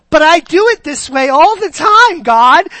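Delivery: high, loud objection in one rush; mock protest. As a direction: play an exasperated believer arguing with God. Voices women protesting to Jesus.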